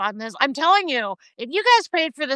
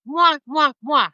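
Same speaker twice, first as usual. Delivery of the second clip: kisses. Real, not acted. Blowing kisses goodbye.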